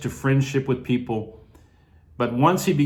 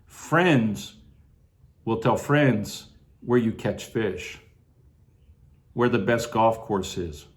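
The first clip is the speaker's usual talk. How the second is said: ordinary talk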